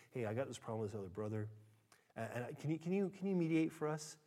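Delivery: low, soft church member asking; hushed, halting plea; meek request. Acted. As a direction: quietly ask for help, hesitant and low. Member timidly asks for mediation.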